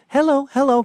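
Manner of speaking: high-pitched luring hello; coaxing call. Acted. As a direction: call out sweetly high, as if to an animal. Luring a deer with birdseed.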